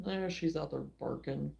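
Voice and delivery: ordinary talk, nothing marked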